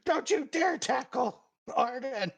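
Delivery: high-pitched indignant yell; yelling from the sideline. Acted. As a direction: yell a warning across the field. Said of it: "Will yells from the sideline".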